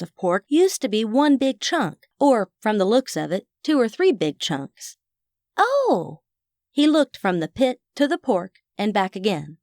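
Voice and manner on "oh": surprised. A narrator's reading. Boy realizes.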